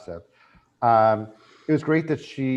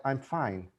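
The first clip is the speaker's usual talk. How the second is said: quiet denial; 9 dB quieter than usual. Retold or imitated. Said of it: "And she's like".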